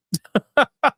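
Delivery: high scoffing laugh; short laugh. Real, not acted. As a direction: laugh at the absurdity. Laughs at critic's claim.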